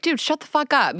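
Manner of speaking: high, exasperated retold retort; mock telling-off with no sweetness; blunt, unsweet retort. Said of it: "I don't have the sweet voice".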